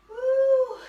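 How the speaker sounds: loud, high, sustained woo; relieved whoop. Real, not acted. Instructor finishing a hard interval, loud high whoop. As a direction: let out a big post-exertion whoop.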